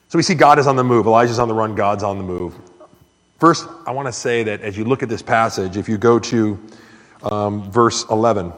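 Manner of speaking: normal talking voice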